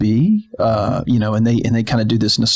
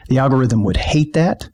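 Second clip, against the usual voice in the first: usual speaking voice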